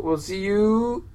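slow, drawn-out radio show sign-off; lightly voiced radio host, slow, signing off listeners; Peter Sagal sign-off. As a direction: stretch the farewell like a quiz-show host. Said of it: "in the immortal words of Peter Sagal".

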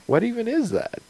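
high, puzzled robot ship voice; baffled ship AI. Pretend. The ship puzzling over a player's request, high and baffled. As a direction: squeak the question like a brand-new mind.

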